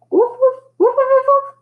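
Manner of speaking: high, loud hooting woofs; dog's horn-like bark. A narrator's reading. Dog coming to the rescue, hooting barks. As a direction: hoot out a run of barks.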